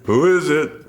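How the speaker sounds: loud, high dopey stoner call; dumb stoner voice. Retold or imitated. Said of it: "My dumb stoner voice".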